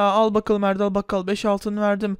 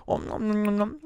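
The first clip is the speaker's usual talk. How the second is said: munching noises; 5 dB quieter than usual. Acted. Eating noises.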